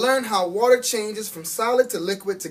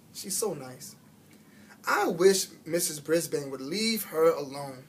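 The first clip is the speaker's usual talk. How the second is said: plain reading voice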